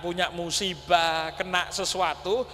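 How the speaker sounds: ordinary delivery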